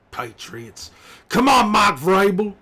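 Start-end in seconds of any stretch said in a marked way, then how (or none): 1.3-2.6 much higher, louder come on; exasperated yell. Acted. Fan yelling at coach Mike Vrabel, frustrated shout. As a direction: bellow at the TV in frustration.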